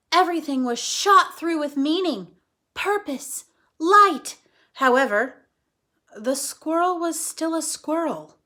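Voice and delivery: lively read-aloud for kids, voicing each character; animated storytime reading; story narration.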